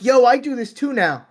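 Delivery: high hammed-up hey-there voice; mock cheery greeting. Guest mocks himself crashing his friends' fun, high hammed-up hello. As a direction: barge in proudly, playing dumb. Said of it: "I'm like".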